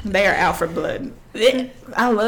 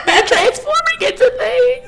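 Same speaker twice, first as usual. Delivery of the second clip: much higher, laughing mock-panicked squeal; 14 semitones higher than usual. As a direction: squeal it in fake terror. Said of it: "And I'm like".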